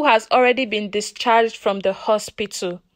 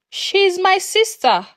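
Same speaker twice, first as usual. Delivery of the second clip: a touch of a tremble, pitched up; lightly shaken, pleading to be believed; mild quaver of distress; shaking voice; 7 semitones higher than usual. Acted. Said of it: "My voice was shaking".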